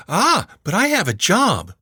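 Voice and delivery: high, bright gotcha comeback; triumphant teen girl. A narrator's reading. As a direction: play the smug niece.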